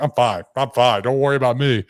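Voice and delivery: acted-out defiant reassurance; insistent brush-off. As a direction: wave off concern loudly. Drunk self insisting he's fine.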